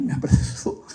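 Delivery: ordinary talk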